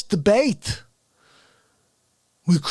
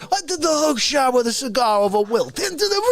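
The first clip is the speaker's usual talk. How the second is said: hammed-up outrage; 4 semitones higher than usual. Bragging about a cigar hook shot into coffee, hammed-up outrage. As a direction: overplay the wounded indignation, stretching key words high.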